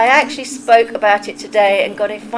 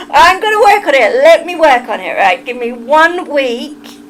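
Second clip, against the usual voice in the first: Southern accent; 3 semitones higher, 6 dB louder than usual. Shown as a demonstration. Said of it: "southern accent".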